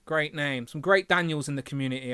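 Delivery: ordinary delivery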